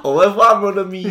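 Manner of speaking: high, loud laughing French farewell; French accent. Pretend. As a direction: sing it out like a cartoon Frenchman. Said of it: "You're just saying French".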